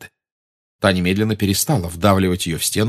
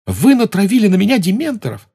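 put-on shocked boy; 12 semitones higher, 6 dB louder than usual. A narrator's reading. Harry voiced in story.

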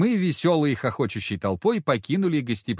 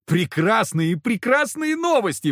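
bright, rising joy, higher; beaming; 11 semitones higher, 5 dB louder, slower than usual. A narrator's reading. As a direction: beam with delight. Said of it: "просиял Ползунов".

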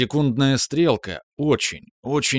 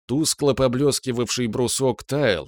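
plain reading voice

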